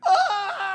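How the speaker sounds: high, loud yelp of pain; child's yelp. Retold or imitated. Little girl yelping at the last swat, loud yelp. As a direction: cry out like a spanked kid. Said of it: "She went".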